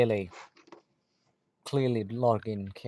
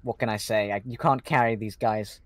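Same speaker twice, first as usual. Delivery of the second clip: mock boastful; 9 dB louder than usual. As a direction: boom out your self-praise like a smug coach. Mock-arrogant caster brag.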